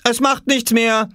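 put-on high, boyish reassuring call; consoling call. A narrator's reading. Oskar comforting his searching mother, calling out. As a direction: call out to comfort someone below. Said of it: "rief er, um sie zu trösten".

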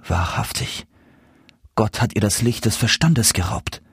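subdued, worried aside; troubled self-talk. A narrator's reading. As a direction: speak to yourself with a touch of sorrow. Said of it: "sprach der Kleine bekümmert zu sich". (leicht bekümmert zu sich selbst)

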